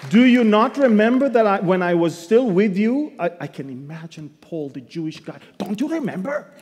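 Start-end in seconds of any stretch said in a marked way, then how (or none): 0.1-3.1 forceful Bible reading from the pulpit; scripture reading. Acted. Preaching reading. 5.6-6.5 put-on a light Hebrew accent as Paul; a hint of a Hebrew-accented Paul. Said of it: "In that Hebrew accent".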